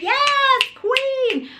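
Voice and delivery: high, delighted yes queen; gushing friend. Retold or imitated. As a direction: cheer her on, way up high. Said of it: "And she was like".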